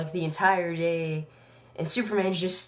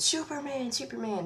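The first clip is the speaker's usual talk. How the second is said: higher, mild mock cries calling out; imitating a kid calling for help from the basement, not full volume; mock scream for help; 8 semitones higher, 4 dB quieter than usual. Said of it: "the kid is screaming for help".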